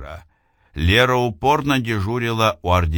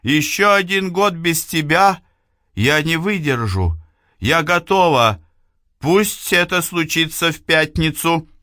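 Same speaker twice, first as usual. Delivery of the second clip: high, pressing plea; desperate pleading; 9 semitones higher than usual. Acted. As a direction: beg him urgently. Эйвилна умоляет. (отчаянная мольба)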